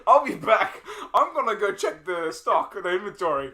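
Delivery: laughing impression. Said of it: "And then he's like".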